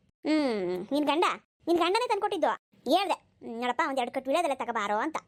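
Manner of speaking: put-on an old mother-in-law doting on her son. Old mother-in-law taunts daughter-in-law. (ಮಗನನ್ನು ಹೊಗಳುವ ಮುದುಕಿ ಅತ್ತೆ ಧ್ವನಿ)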